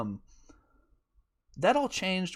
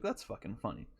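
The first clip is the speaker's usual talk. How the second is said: amused murmur; 6 semitones lower, 10 dB quieter than usual. Retold or imitated. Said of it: "I was like".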